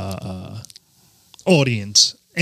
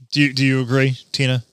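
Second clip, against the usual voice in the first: ordinary talk, nothing marked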